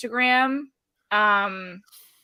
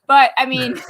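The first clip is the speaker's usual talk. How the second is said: ordinary talk, nothing marked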